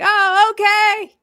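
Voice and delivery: high, loud, stretched-out reply; retold yell back. Answering the fan who yelled his introduction, yelling back. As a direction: yell it back slowly, imitating the exchange. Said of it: "yelling at each other".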